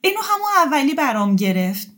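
high, loud startled denial; a scolded child's quick no. Acted. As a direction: flinch like a slapped child.

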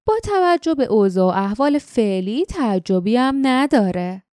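ordinary delivery

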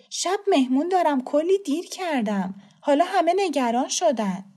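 high, flustered complaint; harried woman. Acted. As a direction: protest to an officer that you have no time.

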